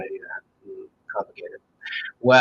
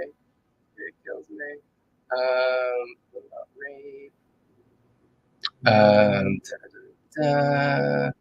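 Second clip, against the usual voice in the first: ordinary talk, nothing marked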